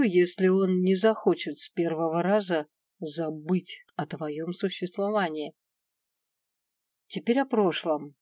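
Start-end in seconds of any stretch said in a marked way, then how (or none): none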